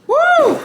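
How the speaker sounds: high shrill-free whoop of shock. Real, not acted. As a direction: let out a sudden loud high woo.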